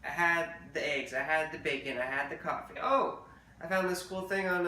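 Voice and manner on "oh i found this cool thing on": high loud burst of realization; suddenly remember something exciting; sudden surprise. Real, not acted.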